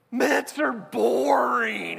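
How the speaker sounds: put-on high, drawn-out childish whine; mock child tantrum. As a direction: imitate a child loudly protesting, dragging the words. Little girl's tantrum, acted out.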